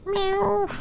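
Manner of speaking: high soft meow; cat meow. Pretend. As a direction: meow like a kitten. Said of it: "Summon my inner cat".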